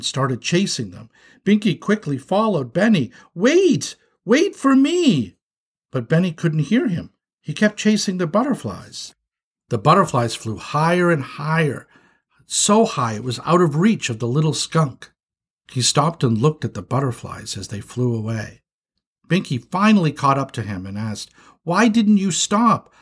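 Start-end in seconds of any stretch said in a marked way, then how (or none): 3.3-5.4 high urgent shout; shrill-pitched, drawn-out call to wait. Acted. Chasing runaway little brother, calling out. 21.6-22.9 high, slow demand; pleading reproach, pitched high; exasperated scolding. Acted. Scolding brother after the chase.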